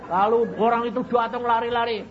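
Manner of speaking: ordinary delivery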